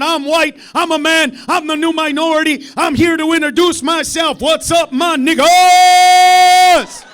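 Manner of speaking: loud, high yelling out a car window; yelled out. Pretend. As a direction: shout it proudly to the street. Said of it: "yell out".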